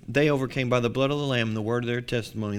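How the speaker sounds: ordinary delivery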